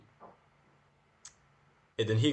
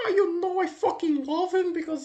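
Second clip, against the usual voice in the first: mock Trump fan; 3 dB louder than usual.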